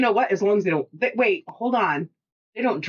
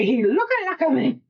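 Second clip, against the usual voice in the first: high-pitched echo of a skit line; playful put-on skit character; comedy skit voice; 6 semitones higher than usual. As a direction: parrot the silly catchphrase up high. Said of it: "That's such a s-, great skit too".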